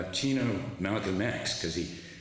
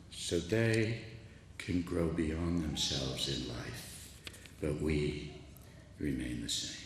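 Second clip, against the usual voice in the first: quiet; 7 semitones lower, 6 dB quieter, slower than usual. Retold or imitated. Student's quiet realisation retold.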